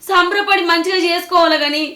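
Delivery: loud, high, indignant tirade; outraged protest. Acted. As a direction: protest in shock and anger. Mother protests son-in-law's demand.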